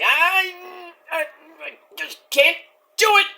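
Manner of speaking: high, whimpering mock agony; torn reluctance. Luchasaurus handed the chair and thinking about it, torn reluctance. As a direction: whimper it haltingly, high-pitched. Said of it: "who's thinking about it. He's kinda, he's like".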